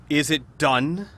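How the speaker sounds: loud, demanding cleric's question; cleric priest. Acted. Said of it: "voice of a cleric priest".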